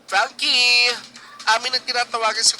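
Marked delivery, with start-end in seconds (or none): 0.1-0.9 calling out. Real, not acted. Host calling absent contestant.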